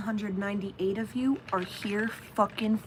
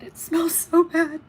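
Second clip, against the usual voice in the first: louder, higher disgusted cry; disgusted exclaim; 7 semitones higher, 7 dB louder than usual. Real, not acted. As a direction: react to the stink. Reacting to smell.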